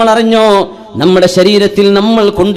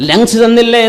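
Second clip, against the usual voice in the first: usual speaking voice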